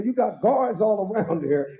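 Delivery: ordinary talk, nothing marked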